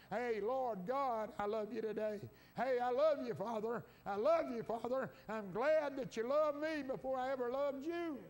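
fervent preacher praising at full voice; exultant praise. Acted. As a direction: call out joyfully to the Lord.

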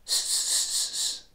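hissed S, S, S held steady; demo S sound. Shown as a demonstration. Teacher letting learners feel the S tongue position. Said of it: "Feel where your tongue is".

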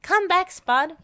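high, loud call; calling after someone. A narrator's reading. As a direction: call out to someone running away. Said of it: "called Wendy".

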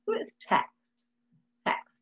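put-on "text" sounded out for a child; sounding out. Shown as a demonstration. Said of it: "Sound it out".

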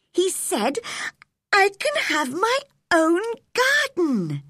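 put-on high-pitched, bubbling girlish delight; excited girl. A narrator's reading. Girl telling Martha she can have a garden, excited. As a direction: burst out with the good news, voice way up. Girl bursting in with good news.

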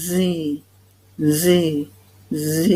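Z said "zee" with a buzzing start; throat-voiced Z. Shown as a demonstration. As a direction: make Z vibrate in the throat. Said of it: "แตะเบาๆที่ลำคอ แล้วพูดคำว่า".

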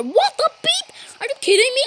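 high, startled squeal of disbelief; real shock at what pops up; shocked outburst. Real, not acted. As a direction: blurt it out, caught completely off guard. Shocked reaction to a picture.

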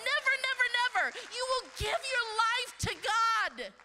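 high, loud repeated never; rising emphatic cry. Real, not acted. Preacher builds to a cry.

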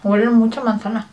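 talking with food in mouth; muffled mid-chew speech; chewing while talking. Real, not acted. Seen 0: Bit cookie at -3s; closed, working mouth while speaking, bitten cookie held.

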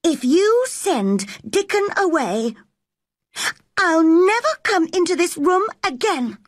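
high-pitched angry threat; angry girl. Acted. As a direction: deliver an ultimatum in a high, angry voice. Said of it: "Mary suddenly became very angry".

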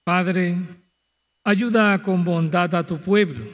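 ordinary talk, nothing marked

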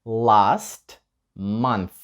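slow, careful question; slow model question. Shown as a demonstration. Teacher modelling the question slowly for students. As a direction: drag it out so learners follow. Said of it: "чи ти це перевірив".

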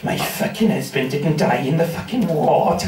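lightly voiced, indignant old Welsh woman. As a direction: mutter it like a disapproving Welsh granny. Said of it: "this old Welsh woman staring daggers at me".

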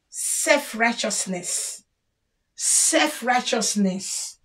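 Self-righteousness murmured slowly as a verdict; soft slow summing up. Shown as a demonstration. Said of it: "names the flaw again".

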